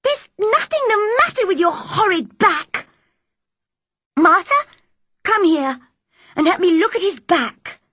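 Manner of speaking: put-on high, loud commanding girl's voice; bossy girl. Mary ordering Martha to help. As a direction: take charge, call the maid over.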